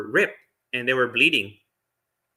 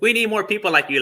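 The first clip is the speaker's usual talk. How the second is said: happy; 8 semitones higher, faster than usual. Retold or imitated. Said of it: "like, very happy".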